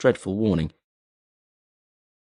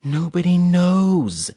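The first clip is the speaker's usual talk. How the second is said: slow, tense, higher hiss of a warning; 10 semitones higher, 4 dB louder, much slower than usual. A narrator's reading. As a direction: bite off each word slowly. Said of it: "she hissed".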